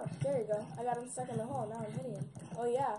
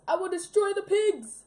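loud, high villainous declaration; villain shout; 7 semitones higher, 14 dB louder than usual. Acted. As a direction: roar the evil plan. Villain declares evil plan.

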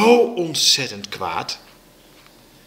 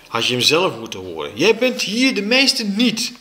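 plain reading voice

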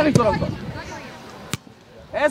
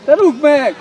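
loud, raised sideline order; shouted command; 4 semitones higher, 8 dB louder, slower than usual. Real, not acted. Coach telling a player to kick, shouted command.

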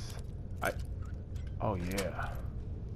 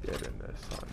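whispered gloating taunt; 14 semitones lower, 15 dB quieter, faster than usual. Acted. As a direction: hiss it under your breath. Gloating aside at enemy.

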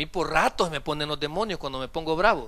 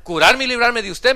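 loud, raised-pitch acted comeback; emphatic retort; 6 semitones higher, 11 dB louder than usual. Healed member answers the pastor back, loud emphatic retort. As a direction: throw it back loudly as the church member.

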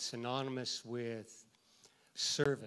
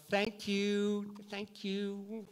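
gushing thanks; 6 semitones higher, 4 dB louder, slower than usual. Retold or imitated. Effusive thanks after church service.

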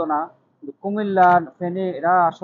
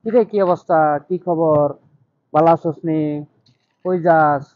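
casual Feni dialect, a bit louder; Feni dialect greeting; 3 dB louder than usual. Shown as a demonstration. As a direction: call out to a friend in Feni dialect. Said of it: "ওই ভাষা উপস্থাপনা করে".

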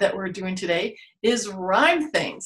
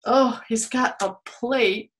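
ordinary talk

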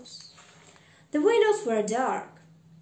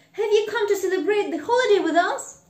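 high, loud, rapid eager question; crying out; 9 semitones higher, 8 dB louder, much faster than usual. A narrator's reading. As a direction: ask in an excited rush. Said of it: "Fred cried".

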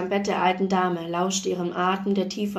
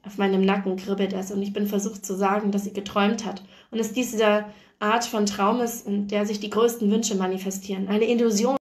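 ordinary delivery, nothing marked

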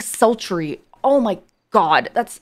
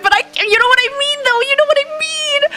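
rising high and squeaky; squeaky strained voice; 13 semitones higher than usual. Real, not acted. Streamer admitting she can't sing it, voice squeaking up high. Straining to reach her notes.

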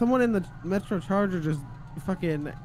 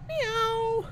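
high-pitched cat meow; mock cat meow. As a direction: let out a long, high, put-on meow. Acts out a cat after "cat fight.

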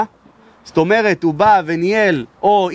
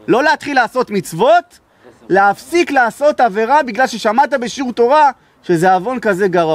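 preaching; 5 semitones higher than usual. Acted. Rising sermon emphasis.

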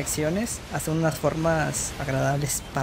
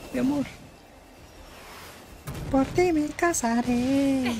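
mock romantic; 9 semitones higher, slower than usual. Mock love talk to game creature.

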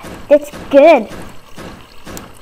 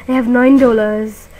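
normal talking voice

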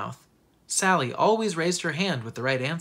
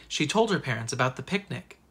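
usual speaking voice

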